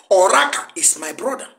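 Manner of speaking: ordinary delivery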